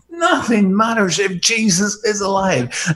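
usual speaking voice

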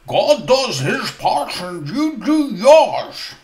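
stern, clipped ruling from a proud doctor; glaring Doctor Law. Acted. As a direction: lay down the rule like a stern doctor. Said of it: "he glared at them and said".

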